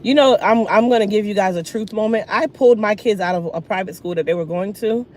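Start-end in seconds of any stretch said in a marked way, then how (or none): none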